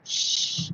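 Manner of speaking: soft, drawn-out shush; hushing shh. Retold or imitated. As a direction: hush someone softly. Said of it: "And I said".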